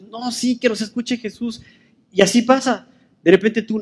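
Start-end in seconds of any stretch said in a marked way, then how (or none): 0.0-1.6 higher, softer insistent plea; insistent protest. Acted. Blind men answering the crowd that hushed them, insistent. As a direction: protest back in a raised pitch, held-down volume.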